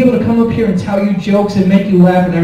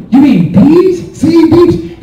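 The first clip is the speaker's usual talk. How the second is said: mocking sing-song; 9 semitones higher, 4 dB louder, much slower than usual. Mocking dad's mispronunciation.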